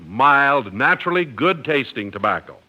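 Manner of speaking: normal talking voice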